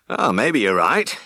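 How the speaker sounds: slow, lightly voiced Yorkshire farmer; a grudging old farmer, drawn out; farmer Daggett voice. Farmer answering the vet, slow and grudging.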